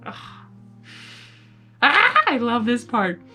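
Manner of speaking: usual speaking voice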